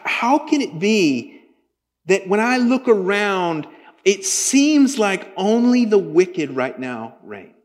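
slow, pleading preacher's build; heartfelt sermon lament; impassioned preaching. Acted. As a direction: lean into the question, slow and intense. Preaching with rising intensity.

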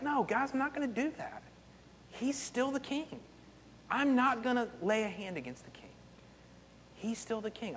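a hint of a quiet, firm David turning them down; softly but firmly refuse; calm refusal. David refusing his men, lightly voiced and quiet.

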